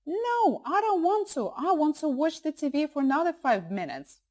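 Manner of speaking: put-on high-pitched protesting child; fighting voice. Shown as a demonstration. Son refusing to switch off cartoons, fighting voice. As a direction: whine and refuse like a kid at bedtime. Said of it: "they will probably be using their fighting voice".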